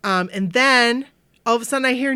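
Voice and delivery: usual speaking voice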